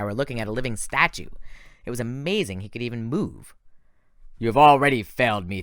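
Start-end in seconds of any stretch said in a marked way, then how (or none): 4.4-5.6 louder, stern general; the general rebuking Thorne; cold rebuke. Acted. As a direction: lay the blame on him heavily.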